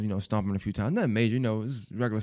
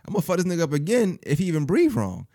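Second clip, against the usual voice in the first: angry outburst; 7 semitones higher, 3 dB louder than usual. Retold or imitated. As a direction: snap back that you'll hit him again. His angry reply to mom.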